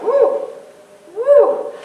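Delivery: high squealing woo; mock woo. Parent mocking a child's alarm, squealing woo. Kids reflecting your stuff.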